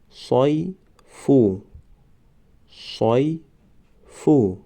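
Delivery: صیفو stretched very slowly, syllable by syllable; drawn-out demo. Shown as a demonstration. Teacher modelling the word for students, very slow. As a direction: draw out صیفو slowly for the class. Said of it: "ما باید همان حرف را فقط آوازش را تلفظ کنیم".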